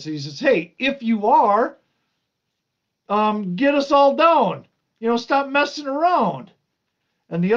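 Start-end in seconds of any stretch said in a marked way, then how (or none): 0.4-6.5 high, loud imitation of a heckler; mocking. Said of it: "is mocking Jesus".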